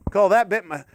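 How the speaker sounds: ordinary talk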